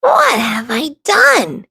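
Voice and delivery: slow unvoiced whisper of regret; slow whispered thought. A narrator's reading. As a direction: breathe it out in dismay. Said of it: "thinks Rick".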